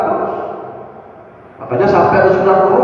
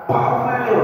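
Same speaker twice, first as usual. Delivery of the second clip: mock harsh delivery of "Kafir", pitched higher; harsh intonation; 4 semitones higher, 5 dB quieter than usual. Preacher imitating hard-liners saying the word, mock harsh. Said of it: "dibawain sama orang yang rada keras".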